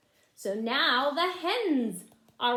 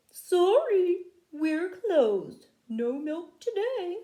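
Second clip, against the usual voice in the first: higher, louder put-on cow voice; cows' strike note; 8 semitones higher, 6 dB louder, slower than usual. The cows' note on the barn door, read in a loud cow voice. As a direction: announce the strike cheekily.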